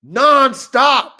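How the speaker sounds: loud, high Nonstop; emphatic shout. Real, not acted. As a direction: punch out Nonstop with awe.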